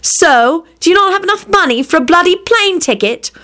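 high-pitched, scornful question; exasperated challenge. Acted. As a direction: push back at a stubborn brother. Exasperated sister challenges her brother.

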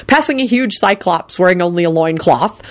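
ordinary delivery

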